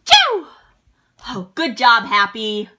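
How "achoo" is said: sneeze. Acted. Character keeps sneezing.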